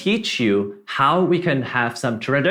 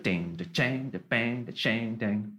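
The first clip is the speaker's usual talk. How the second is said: soft, low "ding, da chang" drum noises; vocalized shuffle groove; 3 semitones lower, 9 dB quieter than usual. Shown as a demonstration. Teacher demonstrates Western shuffle, vocalized groove. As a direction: sound out a swung drum beat quietly. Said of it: "The Western shuffle feel".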